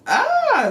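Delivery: loud, high-pitched wail of dismay; frustrated cry. Real, not acted. Reviewer laments the heroine's death, in a high frustrated wail. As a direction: let out a squealing groan of frustration.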